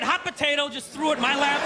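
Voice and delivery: ordinary talk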